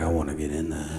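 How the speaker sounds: low, soft retold thought; hushed self-quote. Comic recalls spotting the jacuzzi, low and hushed. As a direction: drop it right down, as if to yourself. Retelling his reaction to the jacuzzi.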